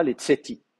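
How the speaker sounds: "les tseti" with a reduced first vowel, stress on final ti; dropped first vowel. Shown as a demonstration. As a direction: let the first vowel of "les tseti" fall away, accent the i. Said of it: "et là, on peut la faire tomber".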